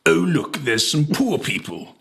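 put-on plummy upper-class English accent, drawled; sneering posh English accent, lightly done; a mild posh English sneer. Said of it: "and I'll do the accent".

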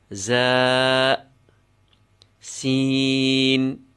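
long-held, level monotone letter names; letter recitation. Shown as a demonstration. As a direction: draw each Arabic letter name out evenly.

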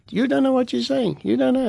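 a politician cutting him off, pitched well up; warning official. Retold or imitated.

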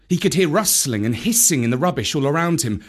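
usual speaking voice

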